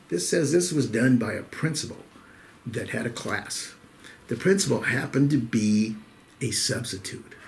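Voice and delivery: ordinary delivery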